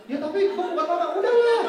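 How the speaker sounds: high, rising exasperated protest; mock exasperation. Babysitter protests to the girl he isn't mama, high and exasperated. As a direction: give up in a huff.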